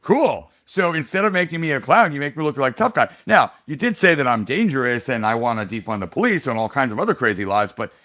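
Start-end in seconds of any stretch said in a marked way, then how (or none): 0.0-3.1 higher, louder mock-cheerful "Cool"; wry sarcastic reply. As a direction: play it as ironic delight. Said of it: "I'm like".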